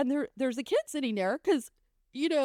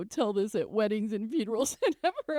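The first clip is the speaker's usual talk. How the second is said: ordinary talk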